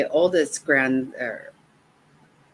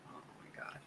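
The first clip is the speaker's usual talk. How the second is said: breathy whisper with no voice; whispered gush; 27 dB quieter, faster than usual. Real, not acted. Grandma overcome with love, whispering. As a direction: mouth it, overwhelmed. Gushing over her grandson.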